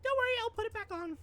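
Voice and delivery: high, squeaky, quick reassurance; high-pitched playful character. Acted. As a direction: put on a high, fast cartoon voice.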